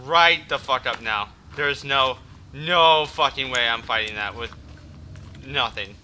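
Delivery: usual speaking voice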